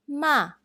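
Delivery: "ma" high, loud, falling sharply, fourth tone. Shown as a demonstration. Teacher models Mandarin fourth tone on ma, falling. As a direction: slam "ma" down like the hey. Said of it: "please listen carefully".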